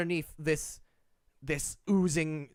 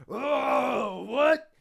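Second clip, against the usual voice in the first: screaming orc; 8 semitones higher, 4 dB louder than usual. Acted. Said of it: "he kinda screams".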